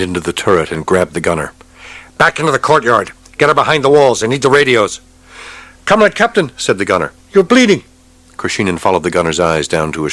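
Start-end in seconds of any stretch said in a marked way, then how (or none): 2.2-5.0 higher, louder urgent command; urgent orders. Acted. Captain ordering the gunner. 5.9-6.5 much higher alarmed voice; alarmed gunner. Acted. 7.3-7.8 much higher alarmed voice; alarmed gunner. Acted.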